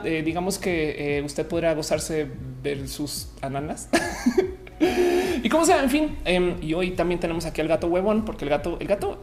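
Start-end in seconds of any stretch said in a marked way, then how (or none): 3.9-5.4 high laugh. Real, not acted. Laugh after joke.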